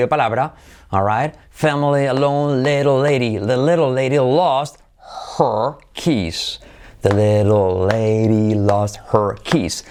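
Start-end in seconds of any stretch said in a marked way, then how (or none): 2.6-9.8 slow, drawn-out pronunciation demo; low, slow, linked teaching model; slow model sentence. Shown as a demonstration. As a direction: stretch each word for learners to repeat.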